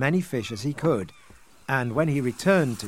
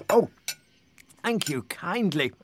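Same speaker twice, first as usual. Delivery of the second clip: small, raised, polite crab; little crab voice; 4 semitones higher than usual. A narrator's reading. Crab thanks the fisherman for its plate, little voice. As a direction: thank them in a little creature's voice. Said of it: "it said as it tucked in".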